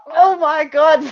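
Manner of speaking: very high, loud startled shriek; shocked exclamation. Retold or imitated. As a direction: yelp as the sparklers go off. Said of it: "I was like".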